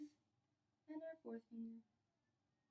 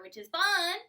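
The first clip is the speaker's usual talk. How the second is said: upbeat; 5 semitones higher, 9 dB louder than usual. Real, not acted. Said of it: "we can play faster".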